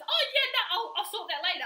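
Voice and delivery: high, loud airy dismissal; mock carefree brush-off; breezy brush-off. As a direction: wave it off brightly. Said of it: "I'll be like, sometimes".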